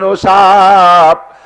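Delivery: loud, long-drawn summons; sermon-style drawn-out hail; calling out. Acted. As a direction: call the snake with a hugely stretched vowel. Prophet calls to the snake.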